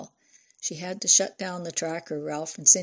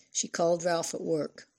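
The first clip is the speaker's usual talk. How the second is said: usual speaking voice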